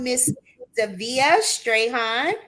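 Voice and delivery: usual speaking voice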